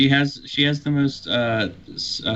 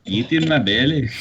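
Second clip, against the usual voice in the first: put-on Irish voice, slightly higher; playful Irish impression; Irish accent; 4 semitones higher than usual. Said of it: "saying it in an Irish voice".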